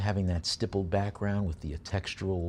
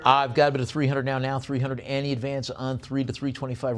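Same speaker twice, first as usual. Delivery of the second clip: brisk auction chant, pushing for bids; auctioneer bid call; 4 semitones higher, 3 dB louder than usual. Real, not acted.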